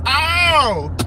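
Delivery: loud excited "Ow!" yelp; excited wrestling-fan yelp; hype yelp. Retold or imitated. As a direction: whoop it like a hyped fan. Hyping Oba's entrance strut.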